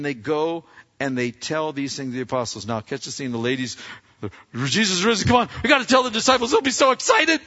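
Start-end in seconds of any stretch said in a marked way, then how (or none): 4.5-7.4 rushed, high, loud burst of thrilled news; excited women. Acted. Ladies racing to tell the disciples, excited. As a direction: act out women bubbling over with news. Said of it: "and they're excited".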